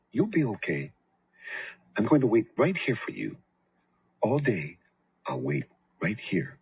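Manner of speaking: lightly voiced gentle mother; a hint of a tender mother; mother's promise. A narrator's reading. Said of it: "she said".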